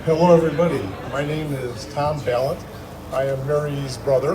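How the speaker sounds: normal talking voice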